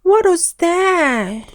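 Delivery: high, slow, strained shriek; frightened shriek. A narrator's reading. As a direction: shriek at a sudden noise. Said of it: "shrieked Mister Jolly".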